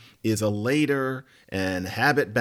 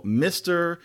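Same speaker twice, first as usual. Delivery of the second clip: normal talking voice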